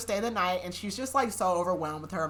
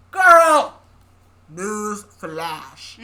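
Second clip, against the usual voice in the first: exasperated call-out; 13 dB louder, much slower than usual. Real, not acted. Reader yelling at the heroine, loud exasperated call. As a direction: yell it at the character.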